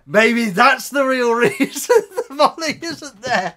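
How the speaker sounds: high, loud, laughing speculation; laughing through words. Real, not acted. Joking with viewers about why Molly stayed home, laughing. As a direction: let the laugh break into the sentence. Laughs at his own joke.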